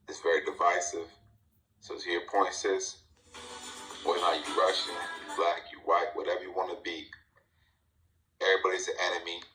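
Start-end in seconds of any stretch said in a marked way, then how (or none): none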